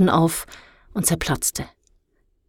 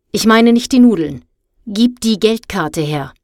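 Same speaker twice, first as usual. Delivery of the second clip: stern demand; 3 semitones higher, 5 dB louder than usual. A narrator's reading. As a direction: confront the culprit sternly. Angry woman confronts the caught boy. (strenge, fordernde Zurechtweisung)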